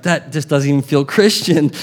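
normal talking voice

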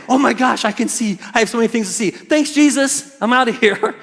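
high, eager and hurried; giddy healed man. Retold or imitated. Healed blind man blurting thanks to Jesus before leaving, giddy and high. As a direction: toss off thanks to Jesus and bolt. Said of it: "Or would I say".

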